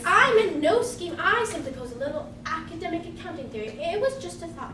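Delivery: anxious, prim disclaimer; flustered protest. Acted. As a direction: back away from the accusation. Accused accountant protests innocence.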